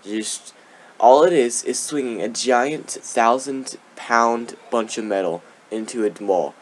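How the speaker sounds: normal talking voice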